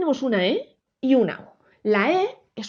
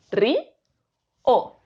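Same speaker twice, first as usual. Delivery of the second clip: "Ri", pause, "o"; split into syllables. Shown as a demonstration. Teacher splitting río for the class, syllable by syllable. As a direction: say "Ri" and "o" apart with a clear gap. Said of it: "¿cómo lo separaría?".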